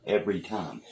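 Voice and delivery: ordinary delivery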